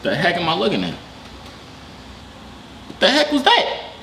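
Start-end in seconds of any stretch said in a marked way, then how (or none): 3.0-3.9 high-pitched, startled question; startled disbelief. Real, not acted. Reacting to what just appeared on screen, startled disbelief. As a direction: shoot up high in shocked disbelief.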